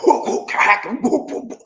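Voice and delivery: whooping, hacking cough imitation; imitated breathless coughing. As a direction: act out a wheezy coughing fit. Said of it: "couldn't hardly breathe".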